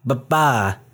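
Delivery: "Ba-ba" as buh-BAH, weight on the second syllable; imitated "Ba-ba", stressed at the end; character's pronunciation. Shown as a demonstration. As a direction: clip the first half of "Ba-ba" and stretch the last. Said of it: "they say".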